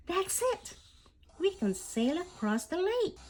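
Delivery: high, bright burst of discovery; sudden idea. Acted. Nila gets a sudden idea.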